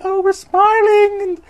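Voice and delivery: squealing high falsetto monster voice; hammed-up giddy monster; excited monsters. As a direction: gush loudly in a silly falsetto. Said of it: "all the other monsters are like".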